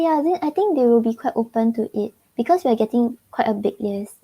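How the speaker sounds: usual speaking voice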